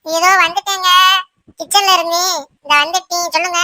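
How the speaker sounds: dutiful wife calling back. Acted. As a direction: call back that you are on your way. Wife answers angry husband's call.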